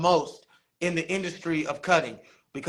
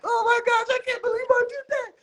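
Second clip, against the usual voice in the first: high, fast, squealing mock sob; mock crying; faster than usual. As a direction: act out happy tears in a squeaky voice. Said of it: "I was crying like".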